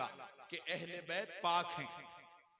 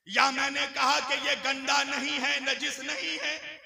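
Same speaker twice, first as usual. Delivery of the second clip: raised, high sermon voice; emphatic preaching; 6 semitones higher, 15 dB louder than usual. Acted. Preacher explaining with his scarf as example, emphatic preaching. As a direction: proclaim the example to the congregation.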